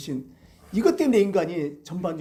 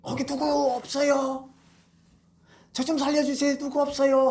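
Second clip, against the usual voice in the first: weak cry for help; 6 semitones higher than usual. Retold or imitated. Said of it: "막 힘이 없으니까 소리치는 거예요".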